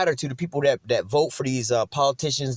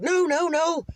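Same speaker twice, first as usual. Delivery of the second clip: very high mock British voice; squeaky, panicky British impression; British dude pleading; 13 semitones higher than usual. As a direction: imitate a scared Brit begging off. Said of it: "He's like some British dude".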